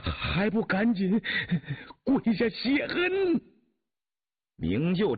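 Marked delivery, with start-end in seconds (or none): 0.1-3.4 slow, high, strained voice; frightened low urging. A narrator's reading. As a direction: urge anxiously through a gasping, drawn-out whimper. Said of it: "福阿广低声说道".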